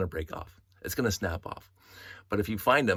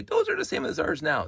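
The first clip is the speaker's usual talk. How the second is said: higher, lightly voiced know-it-all viewer; imagined viewer objection; 10 semitones higher, 3 dB louder than usual. Voicing a viewer who thinks the old bricks match, light mock objection. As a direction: pipe up as a doubting viewer. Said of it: "And you say".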